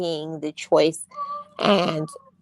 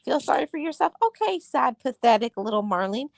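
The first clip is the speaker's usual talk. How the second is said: much higher, sing-song and sarcastic; mocking self-talk; 6 semitones higher, faster than usual. Mocking her own pity party, high and sarcastic. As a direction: tease yourself like a scornful big sister. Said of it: "I say".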